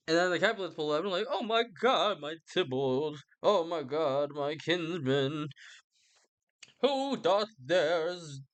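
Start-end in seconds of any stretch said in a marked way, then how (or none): 1.3-8.4 drawn-out, sing-song mock wailing; mock lament. Capulets finding their kinsman slain, mock lament. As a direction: stretch each phrase into theatrical grief.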